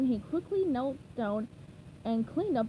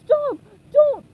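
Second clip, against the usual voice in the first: much higher, much louder short shrieks; panicked yells; 14 dB louder than usual. A narrator's reading. Plankton pleads before the bubble, high loud shrieks. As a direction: scream each word in panic.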